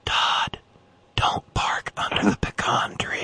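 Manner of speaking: whispered warning of a hunch. Pretend. Friend voicing Todd's gut feeling, eerie whispered warning. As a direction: hush it like an inner voice. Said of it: "friend voices inner warning".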